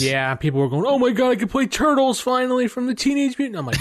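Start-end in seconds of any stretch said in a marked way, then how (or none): 0.9-3.6 excited. Retold or imitated. Fans quoting excited online reactions.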